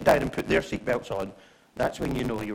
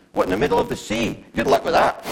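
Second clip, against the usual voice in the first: loud, high-pitched comic incredulity; scornful disbelief; 7 semitones higher, 8 dB louder than usual. Acted. Passenger reacting to the life-jacket briefing on a plane, scornful disbelief. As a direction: blurt it out, mocking the very idea.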